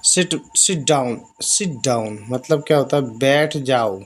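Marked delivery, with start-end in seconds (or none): 0.1-2.2 each English word spaced out slowly; slow dictation. Shown as a demonstration. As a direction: read it slowly for the learners. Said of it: "सिट".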